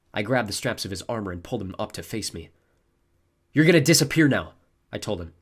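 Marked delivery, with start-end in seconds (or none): 3.5-4.5 louder, higher, forceful warning; acted angry ultimatum; stern threat. A narrator's reading. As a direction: threaten a beaten foe, voice raised. Hero threatens the beaten fear god.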